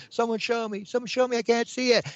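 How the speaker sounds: high-pitched mock wail; imitated crying girl. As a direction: plead like a distressed girl, voice way up high. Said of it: "this girl is crying because she can't".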